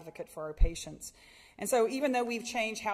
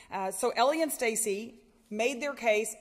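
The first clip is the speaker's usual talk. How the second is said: ordinary talk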